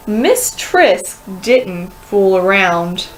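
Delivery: usual speaking voice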